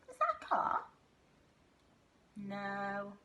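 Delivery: normal talking voice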